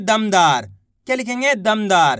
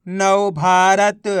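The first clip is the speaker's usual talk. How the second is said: नव Bharat stretched out very slowly; slow blended word; 3 semitones lower, much slower than usual. Shown as a demonstration. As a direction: draw each syllable long to join it. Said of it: "तो मिलके बन जाएगा". (धीरे धीरे खींचकर)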